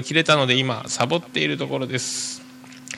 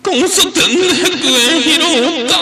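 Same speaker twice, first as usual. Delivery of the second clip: put-on much higher and louder, slowly, like an anime kid; cartoon child voice; 12 dB louder, much slower than usual. Host mimics cartoon girl for his listeners, high squeaky child voice. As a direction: squeal it out like Maruko-chan.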